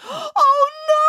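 high, loud distressed wail; wailing Boris. A narrator's reading. Boris wails as the duckling runs into the woods. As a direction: wail it in fresh despair. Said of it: "wailed Boris".

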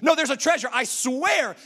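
high, loud protest; worked-up, pleading shout; desperate insistence. Acted. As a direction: insist frantically that you're telling the truth. Insists to disbelieving friends.

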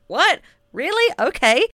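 high, loud, questioning surprise; playful disbelief. Acted. Podcaster reacting to her imagined podcast consultation, playfully incredulous. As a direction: pipe up in delighted mock astonishment.

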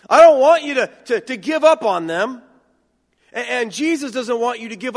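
loud, high plea not to give up; impassioned plea. Real, not acted. Preacher urging congregation not to give up, impassioned loud plea. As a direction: urge them hard not to give up.

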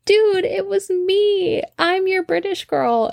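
high, drawn-out confession; excited reveal. Retold or imitated. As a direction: own up in a high, amused voice. Retold reveal to friend.

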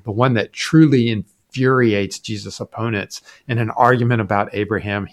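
normal talking voice

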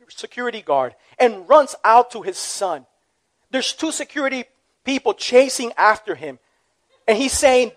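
usual speaking voice

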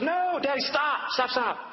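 high, urgent retold plea; alarmed daughter. Daughter sees dad about to throw glass, high-pitched alarm. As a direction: imitate a child crying out to stop him.

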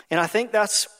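normal talking voice

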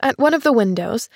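plain reading voice

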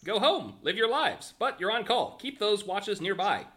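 high, slow, emphatic instructor voice; Buddy, brisk official. Acted. Buddy tells test-takers they are on call, high official voice. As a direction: send them off with firm, high-pitched instructions.

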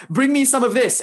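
lightly acted desperate man casting about for tasks. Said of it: "the man starts to get desperate".

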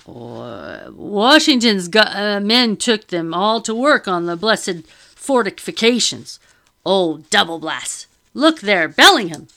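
loud, slow flustered sputtering; flustered fat man. Acted. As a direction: splutter it in a panic. Flustered Charles spots official.

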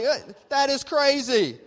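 high, loud, drawn-out scoff; imitated incredulous outburst; mock disbelief. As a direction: act out a listener balking, slowly and high. Acting out listener's disbelief.

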